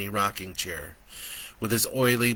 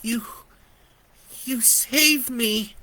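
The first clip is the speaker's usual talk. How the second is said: put-on high, slow small child voice; awed child; 13 semitones higher, slower than usual. A narrator's reading. Brandon thanks Joe, high and slow. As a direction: say it in wonder. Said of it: "Brandon said".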